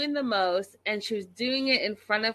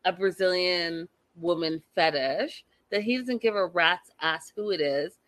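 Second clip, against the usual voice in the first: ordinary talk, nothing marked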